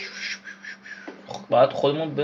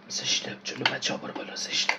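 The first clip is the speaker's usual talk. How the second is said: soft voiceless aside; whisper; 10 dB quieter than usual. Real, not acted. Gamer whispers an embarrassed aside.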